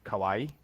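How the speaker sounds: "kawaii" with the final vowel cut short; "kawaii" without the long ee ending; English-speaker mispronunciation. Shown as a demonstration. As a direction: say "kawaii" as two syllables, ka-WAI. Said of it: "they probably don't pronounce it correctly".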